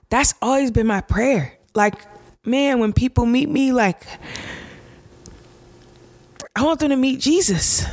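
choking up while speaking of her prayer; getting emotional. Real, not acted. Host tells listeners her prayer, getting emotional. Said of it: "I don't know why I'm getting emotional".

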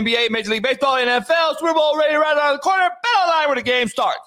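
excited, fast ad read; hype ad read. Acted. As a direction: pump up the sponsor read.

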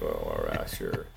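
deep, dry "well, all right, sure". Retold or imitated. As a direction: drop way down, doubtful. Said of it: "I'm like".

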